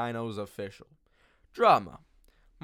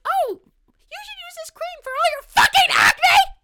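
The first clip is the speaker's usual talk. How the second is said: high, loud shrieking mockery; hammed-up high screech of fake helpfulness; mocking yell; 14 dB louder than usual. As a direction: shriek it like an obnoxious skincare fan. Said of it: "even though it might be offensive".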